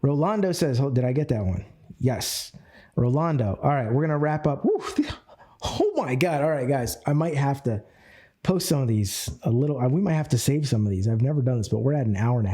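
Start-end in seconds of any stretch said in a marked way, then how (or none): 4.6-6.4 laughing. Real, not acted. Laughing at the long session.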